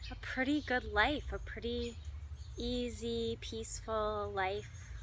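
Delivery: measured delivery with long, spacious pauses; guided meditation. Acted. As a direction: lead listeners gently through imagery, pausing between phrases. Leads animal imagery meditation.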